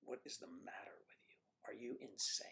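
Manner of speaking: voiceless, muttered disbelief; exasperated whisper. Retold or imitated. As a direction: hiss it under your breath. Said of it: "It's just".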